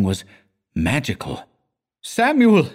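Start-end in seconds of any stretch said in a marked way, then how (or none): 2.0-2.7 high-pitched cry of sudden terror; alarmed cry. A narrator's reading. Pupil calling his old teacher in terror, high alarmed cry. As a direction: cry out the name in panic, pitched way up. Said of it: "cried Stenio".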